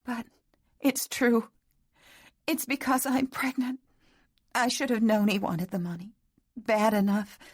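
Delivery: high, tearful confession; tearful young woman. A narrator's reading. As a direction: pour it out through sobs. Catherine confessing in tears.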